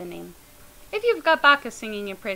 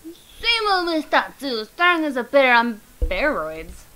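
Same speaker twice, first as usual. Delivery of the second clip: attempted character voice; 5 dB louder, slower than usual. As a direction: try out a new voice, slowly. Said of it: "I don't know how to do this voice".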